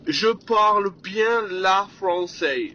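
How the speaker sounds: put-on slow, careful broken French with a foreign accent; mock foreign accent in broken French; foreign accent imitation. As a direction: imitate the client's clumsy French, slowly. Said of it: "il a dit".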